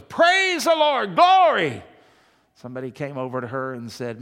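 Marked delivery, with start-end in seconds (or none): 0.0-1.8 much higher, slow, loud cry of Hallelujah; hammed-up shout. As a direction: belt it out like an overjoyed country churchgoer. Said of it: "She goes".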